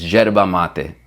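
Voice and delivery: YERBA MATE with Argentine zh start and "mah-teh"; correct Argentine pronunciation. Shown as a demonstration. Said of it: "say it correctly too".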